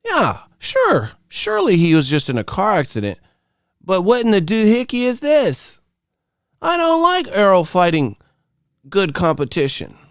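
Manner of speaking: high put-on voice of a grudging objector; high, hammed-up complaining; mock old-media complainer.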